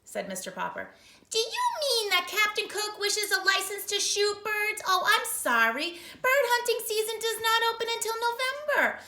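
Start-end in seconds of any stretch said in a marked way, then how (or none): 1.3-9.0 high, loud prim clerk; City Hall clerk, higher and louder; clerk on the phone. Acted. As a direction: sound like a fussy official.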